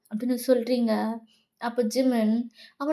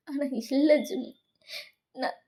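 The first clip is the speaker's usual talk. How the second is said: ordinary delivery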